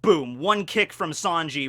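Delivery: ordinary talk, nothing marked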